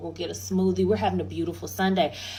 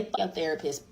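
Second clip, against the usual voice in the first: muttered version; 6 semitones lower, 7 dB quieter than usual. Retold or imitated. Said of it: "but I did say".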